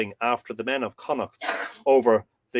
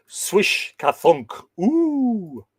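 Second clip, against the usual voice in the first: slow swish, thunk, then a high "ooh"; drawn-out spoken sound effects ending high; arrow sound effects; 8 semitones higher, 4 dB louder, much slower than usual. Shown as a demonstration. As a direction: act out an arrow flying and hitting.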